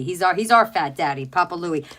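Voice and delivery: ordinary talk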